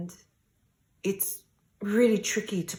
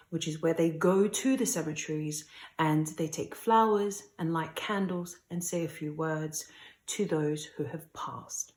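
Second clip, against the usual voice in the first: ordinary delivery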